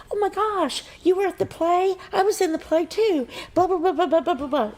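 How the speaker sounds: high, gushing excited chatter; squealing with excitement about the play. Retold or imitated. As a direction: gush like a thrilled fan. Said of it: "I was, like, so excited".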